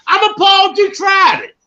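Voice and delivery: very high, loud cry of indignation; outraged shout. Acted. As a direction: yell it as a mock confrontation. Acts out confronting someone with the word.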